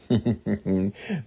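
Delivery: a short, soft mother's laugh; gentle amused chuckle; light laugh. Acted. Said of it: "Missus Quimby laughed".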